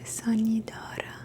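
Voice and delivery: ordinary delivery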